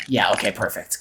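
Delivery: ordinary talk, nothing marked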